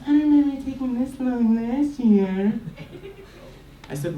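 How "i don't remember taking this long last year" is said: high, lightly voiced bratty little girl. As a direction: sass it like a spoiled kid. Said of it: "She goes".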